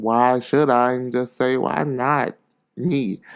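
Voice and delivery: ordinary delivery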